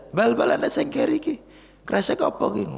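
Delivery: high, curious mother voice; mother calling. Retold or imitated. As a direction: call after him like a fussy mother. (suara ibu tinggi memanggil anaknya)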